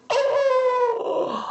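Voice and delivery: very high, long-held moan; reviving moan. A narrator's reading. Fainted father gets his breath back, moaning. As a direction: let out a thin wail on coming to. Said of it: "江环楚这口气儿缓过来了".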